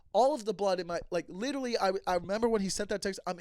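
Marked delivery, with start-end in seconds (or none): none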